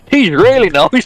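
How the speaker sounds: high, mock-polite voice; a high, sugary fake-nice remark; mock sweet understatement. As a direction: say it far too sweetly. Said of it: "Just going".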